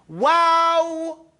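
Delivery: "Wow" drawn out on a level note; proper pronunciation. Shown as a demonstration. Teacher urging students to speak proper, long chanted "Wow". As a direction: sustain "Wow" fully, never lazy. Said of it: "Speak proper".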